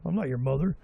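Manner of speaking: ordinary talk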